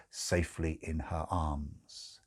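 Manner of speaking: ordinary delivery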